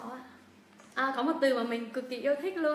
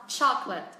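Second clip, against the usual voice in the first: "chocolate" as "CHOC-let", dropping on "let"; two-syllable "chocolate" with falling end; falling after stress; slower than usual. Shown as a demonstration. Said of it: "chúng ta xuống giọng".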